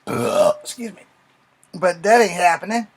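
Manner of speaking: ordinary talk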